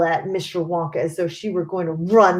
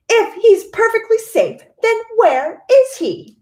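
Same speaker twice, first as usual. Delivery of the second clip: much higher and louder sharp demand; mother snapping at Mister Wonka in a shrill high voice; snapped; 7 dB louder than usual. A narrator's reading. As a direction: snap the question at him. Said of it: "snapped Missus Gloop".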